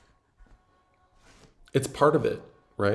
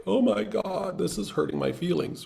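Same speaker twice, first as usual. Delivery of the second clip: mock hurt feelings; 8 semitones higher, 5 dB quieter than usual. Mocking hurt beginner agent.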